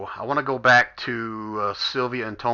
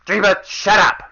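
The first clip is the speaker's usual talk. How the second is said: loud, higher angry snap; mom snapping back; 7 semitones higher, 5 dB louder than usual. Acted. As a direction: bark back at the kid. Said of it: "She goes".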